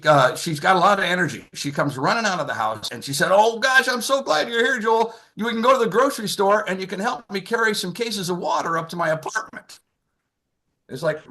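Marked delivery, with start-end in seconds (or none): 3.3-9.8 put-on excited old mom. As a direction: play his mom gushing, a notch up in pitch. Mom voiced in story.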